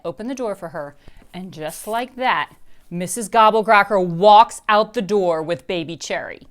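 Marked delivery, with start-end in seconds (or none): none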